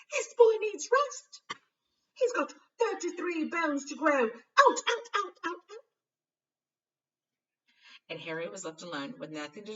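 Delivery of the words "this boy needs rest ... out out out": shrieking high furious shout; shouting nurse. A narrator's reading. Nurse shouting the team out of the ward, furious. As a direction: drive them all out yelling.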